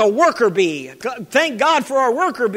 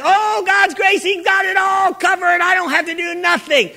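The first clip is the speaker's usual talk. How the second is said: high, whiny mocking of a lazy believer; 5 semitones higher than usual. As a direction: mimic someone who expects grace to do everything.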